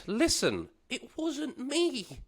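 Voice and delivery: high, slow, soft whine; whiny denial. Retold or imitated. Pleading with guard outside.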